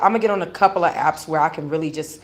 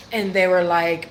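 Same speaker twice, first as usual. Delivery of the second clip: ordinary talk, nothing marked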